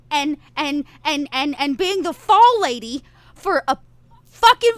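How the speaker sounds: rally the scared group, voice rising with anger; upset rallying speech. Acted. Leader scolding the bickering group in the van, upset and loud. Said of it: "I say".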